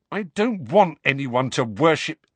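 Harry flaring up; hotly. A narrator's reading. Harry starts hotly at Hermione. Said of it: "Harry began hotly".

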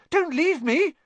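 very high, loud squeal; frightened plea. A narrator's reading. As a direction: beg in a squeaky panic. Frightened boy begs not to be left.

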